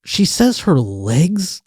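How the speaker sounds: high, slow, baffled question; bewildered questioning. Retold or imitated. Relaying her injury to bouncers while on mushrooms, bewildered. Quoting himself, confused, to bouncers.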